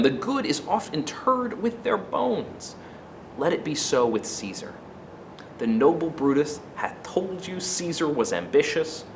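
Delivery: soft, measured Antony, coaxing the crowd; Antony soothes a hostile crowd, soft and gentle; gentle oratory. Acted. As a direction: start very gently, win them over. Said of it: "he starts out very gently".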